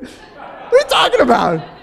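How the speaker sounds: can't believe what he just heard; laughing disbelief. Retold or imitated. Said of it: "I was like".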